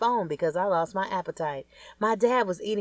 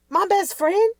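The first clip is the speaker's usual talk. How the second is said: much higher and louder shocked question; learning your best friend betrayed you, higher, louder shock; shocked disbelief; 7 dB louder, slower than usual. Acted.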